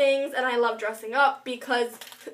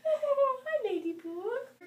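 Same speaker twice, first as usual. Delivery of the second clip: put-on baby talk to a dog; 10 semitones higher, 8 dB quieter, much slower than usual. Greeting the dog.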